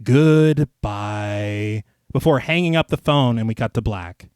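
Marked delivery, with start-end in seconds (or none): 0.0-1.8 lightly sung eerie farewell; a hint of a creepy killer's sing-song; eerie sing-song goodbye. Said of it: "very eerily and in an almost sing-song way says".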